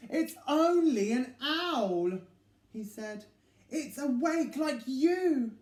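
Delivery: slow, patient explanation; calm the little one down; patient reassurance. Acted. Big Mouse reassures slowly.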